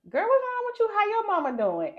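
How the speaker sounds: coworker voiced high and loud; high concerned coworker asking; high mock coworker. Coworkers ask her, retold.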